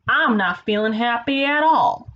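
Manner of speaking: put-on slow, drawn-out country accent for Kansas; simple put-on country accent. Kansas complaining of unhappiness, slow simple country accent. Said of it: "It's just a really simple accent".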